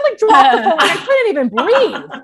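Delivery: high and loud, slowing down; breathless with excitement; overwhelmed. Retold or imitated. Host retelling the text from Brooke, high and loud.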